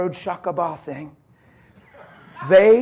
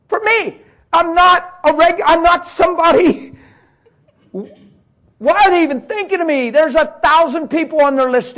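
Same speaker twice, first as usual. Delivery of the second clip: high, loud, choked-up disbelief; real tearful outburst; 10 semitones higher, 8 dB louder than usual. Real, not acted. Preacher overwhelmed that strangers prayed for him, high loud tearful voice. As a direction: cry it out, overwhelmed at being thought of.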